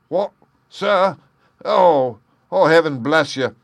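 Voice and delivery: loud, high grateful outburst; overjoyed relief. Acted. Jem thanking Holmes for letting him go, loud and high. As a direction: gush out thanks, overwhelmed.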